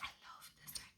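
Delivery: very soft whispered self-quote; retold awed whisper. Retelling first sight of a friend's deck, awed whisper. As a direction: whisper it as if smitten.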